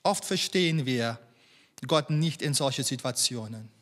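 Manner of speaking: ordinary talk, nothing marked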